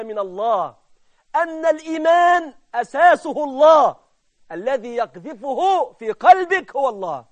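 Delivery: slow, emphatic exhortation with drawn-out words; swelling, deliberate sermon voice; fervent preaching. Real, not acted. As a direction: press the certainty on the congregation. Preaches at full force.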